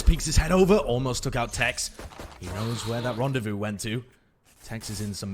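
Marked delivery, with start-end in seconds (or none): 0.0-1.9 excited. Real, not acted. Caster reacts to close play.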